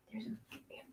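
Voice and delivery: barely audible whisper. Real, not acted. Owner whispers to the cat about the catnip. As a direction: breathe it out with no voice.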